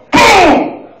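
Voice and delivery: high, shouted boom sound; loud boom. Retold or imitated. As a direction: shout the impact of the hit. Mom hits the VCR.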